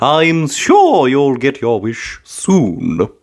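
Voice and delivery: put-on a kindly grandma soothing a child; bright, gentle old-lady reassurance; Granny Pig's voice. A narrator's reading. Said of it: "said Granny Pig".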